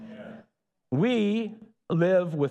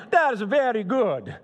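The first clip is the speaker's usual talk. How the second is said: put-on a light, playful Italian accent, higher and louder; 7 semitones higher, 4 dB louder than usual. Preacher imagining God praising creation in a playful Italian accent. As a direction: voice God as a proud Italian admiring his work. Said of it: "Probably talks like this".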